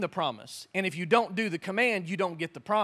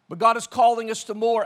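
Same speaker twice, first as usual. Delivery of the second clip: loud preaching; 3 semitones higher, 7 dB louder than usual. Real, not acted.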